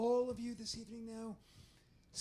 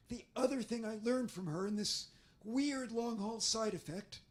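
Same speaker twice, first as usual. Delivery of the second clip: ordinary talk, nothing marked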